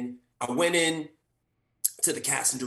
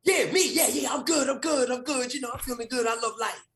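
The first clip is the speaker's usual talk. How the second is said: higher, brighter overeager insistence; mock-cheerful, much higher overeager voice; overeager character; 9 semitones higher than usual. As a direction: insist you're fine, too eagerly.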